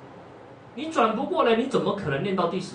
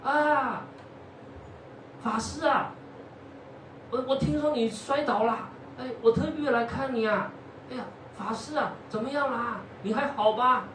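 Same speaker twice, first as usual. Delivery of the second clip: higher, eager novice voice; eager young monk; 7 semitones higher than usual. Acted. New novice visits the injured monk, higher eager concern. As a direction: sound keen and a little nervous. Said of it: "可能刚出家没多久".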